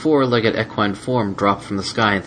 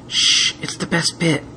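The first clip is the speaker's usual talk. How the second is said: slow whispered shush; hushing whisper; 8 semitones higher, slower than usual. Acted. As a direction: hush them, eyes on the show. Celestia hushing during show.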